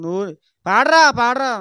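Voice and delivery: loud insistent order; mother's command. Retold or imitated. Mother at family prayer telling her son to sing, loud insistence. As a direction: push him to sing, loudly.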